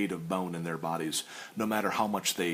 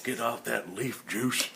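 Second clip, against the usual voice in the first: character voice. Pretend. Continuing the character bit.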